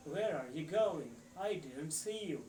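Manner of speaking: "Where are you going?" with a long rising stress on "Where", native-style tune; "Where are you going?" said slowly, leaning on "Where"; English rhythm and tune. Shown as a demonstration. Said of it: "ये जो ऐसे pressure होता है ना, जो tune सी बनती है".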